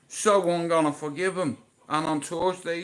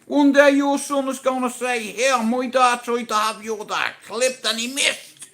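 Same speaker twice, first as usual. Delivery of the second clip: excited shout; 9 semitones higher, 7 dB louder than usual. Retold or imitated. Retold excited promise to friend.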